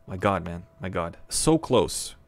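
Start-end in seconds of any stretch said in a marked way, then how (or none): 1.3-2.2 loud, higher "So close" cried out in frustration; louder exclaim. Real, not acted. Player groaning at a near miss, loud and frustrated. Narrowly missing goal.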